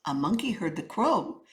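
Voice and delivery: usual speaking voice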